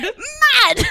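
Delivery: high, laughing British shout; mock British accent. Guest echoing the host, shouted mock British. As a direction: shout it back in silly British. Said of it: "Let's hear a British accent".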